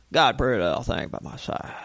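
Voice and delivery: low, slurred, quick country mumble; mock smooth country boy; half-awake country drawl. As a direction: run the words together in a sleepy country accent. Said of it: "talk like you're half awake".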